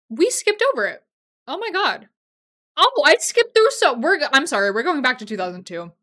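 loud, high-pitched alarm at a slip; flustered, urgent outburst; dismayed surprise. Real, not acted. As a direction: blurt it out as if you just caught your mistake. Realizing she skipped a favorite movie.

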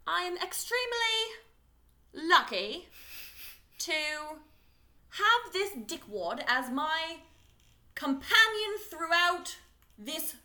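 high, slow put-on British accent; mock-British thank-you, with long halting pauses; Young Hermione. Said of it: "your British accent is based on Young Hermione".

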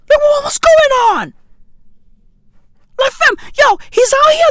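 baffled outburst at a friend's look; bewildered shout. Real, not acted. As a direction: shout it in disbelief. Ranting about Wycliffe's outfit.

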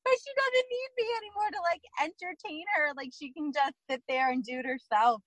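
high-pitched retold upset; emotional. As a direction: imitate an emotional protest, starting very high and easing down. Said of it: "I seriously got emotional about it".

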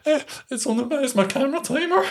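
high, flustered squeak of panic; panicked. Retold or imitated. To security at the casino, high panicked squeak. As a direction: blurt it out, caught off guard and scared. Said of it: "I was totally panicked".

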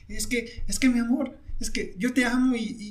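lightly voiced weepy boyfriend begging; mock tearful plea. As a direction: whine out the promise like a sobbing kid. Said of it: "Y el chavito otra vez".

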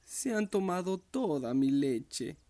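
lightly voiced small bear, high and slow with a hint of tears; a small cub whimpering gently; little bear crying softly. A narrator's reading. Baby bear sadly finds his milk gone, a mild tearful voice. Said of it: "dijo llorando y con voz suave".

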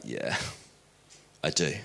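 low, subdued, grudging admission; annoyed. Acted. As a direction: give in quietly, irked at being asked. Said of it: "Simon gets annoyed".

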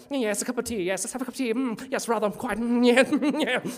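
hammed-up English gentleman accent; posh English impression. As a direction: send up a stuffy Englishman over tea. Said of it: "really English guy who was like".

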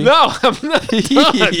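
loud, drawn-out high protest; insistent shout. Real, not acted. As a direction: refuse to yield the floor, loudly. Cuts in on co-host's interruption.

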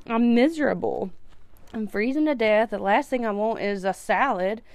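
normal talking voice

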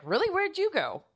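sharp high-pitched surprise; surprised really. Retold or imitated. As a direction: blurt it out, startled and curious. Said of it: "And that's how I said it".

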